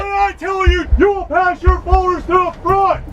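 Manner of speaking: drill sergeant shout. Real, not acted. Drill sergeant ordering recruits.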